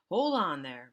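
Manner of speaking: loud, sharp order; father stopping the fleeing man, commanding loudly. A narrator's reading. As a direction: stop him cold with a loud order. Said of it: "Mister Drew commanded sharply".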